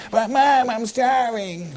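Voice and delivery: put-on whiny child. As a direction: stretch out "starving" like a whining kid. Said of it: "you ever had your kids say".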